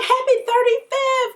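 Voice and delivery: high, loud, sing-song wish; mom's sing-song voice. Retold or imitated. Mom wishing the wrong age, high sing-song. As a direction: chirp it brightly way up high. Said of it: "She's like".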